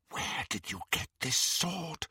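soft menacing half-whisper. Acted. Bellatrix questioning Greyback, soft menacing half-whisper. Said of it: "she whispered to Greyback".

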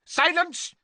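high, loud, sudden outburst; sharp command. A narrator's reading. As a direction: shut the servant up in one burst. Master cuts off spluttering servant.

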